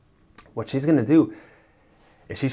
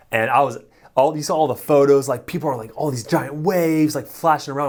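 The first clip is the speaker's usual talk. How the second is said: building excitement, higher and louder; excited; 5 semitones higher, 5 dB louder than usual. Retold or imitated. Narrator recalling the rafting photos, rising excitement. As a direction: hype up the giant waves in the photos. Hyped about giant waves in photos.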